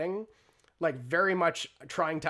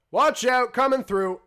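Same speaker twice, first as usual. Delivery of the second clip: loud, higher-pitched warning shout; calling out; 9 semitones higher, 11 dB louder than usual. Acted. Teenager shoving past with a crate, calling out. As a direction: holler to clear a path through the crowd. Teenager pushing through a crowd.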